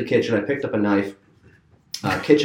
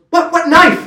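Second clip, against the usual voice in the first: alarmed question; 5 dB louder than usual. Retold or imitated. Brother quoted, alarmed.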